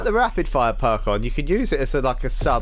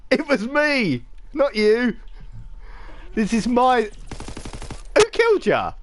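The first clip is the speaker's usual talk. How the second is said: excited gloating; 11 semitones higher than usual. Real, not acted. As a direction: crow at your mate that the kill was yours. Gets a kill, gloats at Rich.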